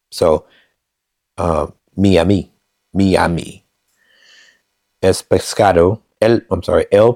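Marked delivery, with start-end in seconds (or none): 2.0-3.6 "Miami" as mee-AH-mee; Spanish pronunciation. Shown as a demonstration. Learner practising the corrected word, "Miami" said the Spanish way, mee-ah-mee. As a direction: say "Miami" with an ee in the first syllable. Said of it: "pronounced like E in Spanish".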